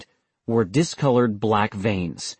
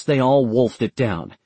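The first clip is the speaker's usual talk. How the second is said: ordinary delivery, nothing marked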